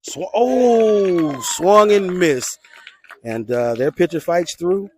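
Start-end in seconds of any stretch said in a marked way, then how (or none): 0.0-2.6 loud, high, stretched-out oh, slow punchy call; excited play-by-play. Real, not acted. Announcer reacting to a batter's swing and miss, excited. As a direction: cry out at the big miss, drawing it out.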